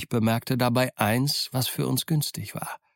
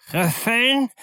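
Trüffeln screeched much higher and louder; shrieking; 12 semitones higher, 4 dB louder than usual. Acted. Mary echoes Silver's word to the pirates, shrieking. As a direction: shriek Trüffeln back in disbelief. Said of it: "wiederholte Mary kreischend".

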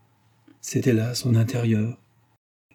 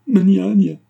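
high, loud, pleading cry; plaintive cry; 10 semitones higher, 9 dB louder than usual. A narrator's reading. Sick boy crying out for his old nurse, plaintive and loud. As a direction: call out for her like a sick child. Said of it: "cria Serge avec un accent plaintif".

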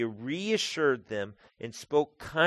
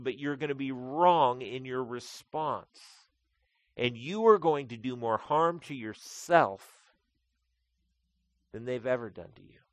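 fervent preaching; 3 semitones higher, 6 dB louder than usual. Acted. Pastor warning congregation about hurt, impassioned preaching. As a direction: drive the point home to the folks.

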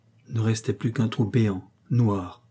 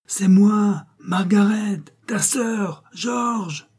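high, drawn-out call; 9 semitones higher, much slower than usual. A narrator's reading. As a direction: call to a brother out of sight, pitched way up. Sister calls out to her brother. (appel aigu, syllabes étirées)

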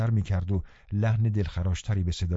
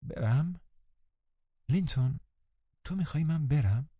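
higher, softened, sad questioning; sorrowful; 6 semitones higher, 4 dB quieter than usual. A narrator's reading. Catherine leaning to Linton, asking if she should go, sorrowful. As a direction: ask it sadly, hoping to be stopped. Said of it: "با غم و غصه گفت".

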